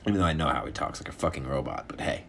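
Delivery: ordinary delivery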